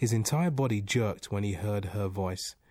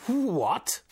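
high, loud, startled demand; 13 semitones higher, 7 dB louder than usual. A narrator's reading. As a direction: blurt it out in disbelief. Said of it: "he demanded".

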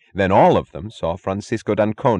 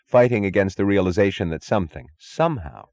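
ordinary delivery

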